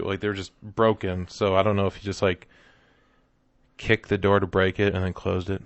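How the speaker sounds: ordinary talk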